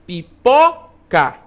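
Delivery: "Pipoca" very slow, syllable by syllable, "po" loudest; stress on middle syllable. Shown as a demonstration. Teacher confirming the answer to pupils, "Pipoca" slowly split, stressed on "po". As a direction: punch the "po" in a drawn-out "Pipoca". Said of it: "com maior intensidade".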